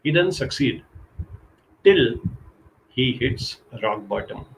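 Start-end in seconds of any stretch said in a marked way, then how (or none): none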